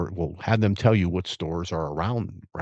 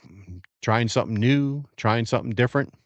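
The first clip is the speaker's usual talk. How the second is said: ordinary talk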